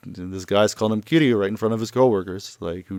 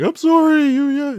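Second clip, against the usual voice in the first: very high, loud mock wail; wailed inner apology; 6 dB louder, slower than usual. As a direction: cry out the apology in a hammed-up high voice. Kiryu's imagined cry of apology.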